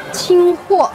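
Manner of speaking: ordinary talk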